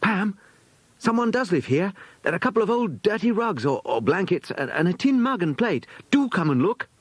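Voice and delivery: put-on high eager girl pointing out a find; excited. A narrator's reading. Girl beckoning her friend in excitement, high and eager. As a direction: urge her friend to come see. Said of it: "beckoned to Pam in excitement".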